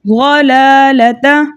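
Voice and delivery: slow chanted "ग़लालता" with the ग़ said full-mouthed and heavy; recitation demo. Shown as a demonstration. Teacher models the word twice for students, slow chant. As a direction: draw the word out slowly, twice. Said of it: "हम कैसे पढ़ेंगे?".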